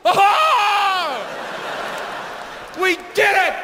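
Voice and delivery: high, hammed-up astonished cry; mock surprise. Crowd feigning surprise at a band's return, mock astonishment. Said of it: "we always act surprised".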